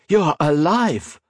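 high, drawn-out astonishment; incredulous exclamation. A narrator's reading. Miss Hakkendottir finding Modo alive, incredulous exclamation. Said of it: "she exclaimed, staring incredulously".

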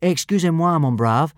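polite French, said with mind elsewhere; absent-minded French. A narrator's reading. Boy not concentrating in class, absent-minded French. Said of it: "said Hiccup absent-mindedly".

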